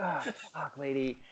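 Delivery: exasperated mutter. Retold or imitated. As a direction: swear at the lady under your breath. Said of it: "I'm like".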